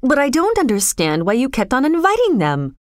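understated outcry; crying out. A narrator's reading. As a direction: protest in baffled distress. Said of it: "John cried out".

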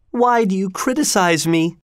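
high-pitched, hurt protest; wounded queen. A narrator's reading. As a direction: protest as a reproached lover, voice high.

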